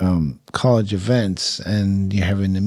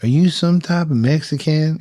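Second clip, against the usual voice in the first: "some type of Mexican" asked higher and louder in a cowboy voice; the Oklahoma local sizing him up, higher and louder; cowboy asking; 7 semitones higher, 4 dB louder than usual. Retold or imitated. Oklahoma equipment manager voiced in story.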